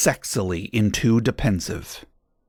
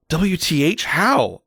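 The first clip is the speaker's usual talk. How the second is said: mild mock scream, pitched up; 6 semitones higher, slower than usual. A narrator's reading. Girl challenging her teacher, mild mock scream. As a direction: exclaim it higher, only half screaming. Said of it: "I screamed".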